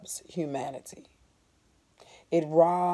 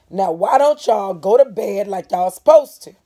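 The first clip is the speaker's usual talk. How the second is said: louder, scolding parent; a hint of a stern mother; like Mama; 4 semitones higher, 12 dB louder than usual. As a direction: order them to bed like a mother. He sounded like Mama.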